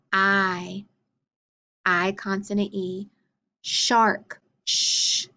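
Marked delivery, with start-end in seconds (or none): 4.7-5.3 sh hissed without voice; letter sound. Shown as a demonstration. Teacher gives the card's sound, unvoiced sh. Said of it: "digraph S H".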